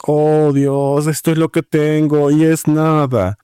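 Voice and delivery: mock plea held on one drawn-out pitch; hopeless supplicant. Believer approaching God empty-handed, mock hopeless plea. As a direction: imitate a helpless, droning prayer. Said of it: "esta es la manera en que la gente se acerca".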